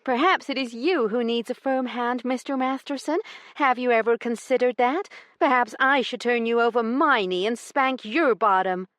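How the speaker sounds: high, taunting lady's voice; mocking taunt. A narrator's reading. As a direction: turn his words back on him. Heroine taunts him.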